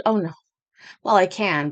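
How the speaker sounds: ordinary delivery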